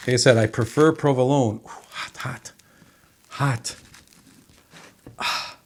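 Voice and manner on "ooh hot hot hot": breathy gasps at the heat; hot hot hot. Real, not acted. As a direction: hiss it out, too hot to hold. Food too hot to hold.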